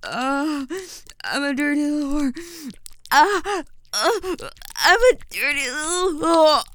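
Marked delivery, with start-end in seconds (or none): 1.2-6.6 loud, high, near-screaming; shouted on command. Shown as a demonstration. Ordered to say it louder, shouting it. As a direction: yell it out as ordered. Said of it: "say it louder".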